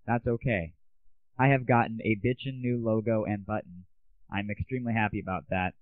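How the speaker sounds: normal talking voice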